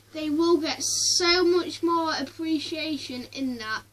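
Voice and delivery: ordinary talk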